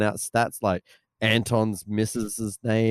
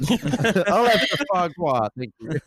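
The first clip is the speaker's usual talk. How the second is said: lightly put-on French chef accent, slow; a touch of a French chef ordering, French accent; 6 semitones higher, slower than usual. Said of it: "He sounds like a French chef".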